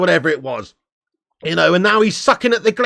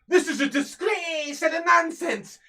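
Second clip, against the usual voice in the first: much higher, Irish-flavoured rant voice; Roy Keane impression; 12 semitones higher, 5 dB quieter, slower than usual. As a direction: growl out a disgusted pundit rant as Roy Keane. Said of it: "Roy Keane would be throwing punches".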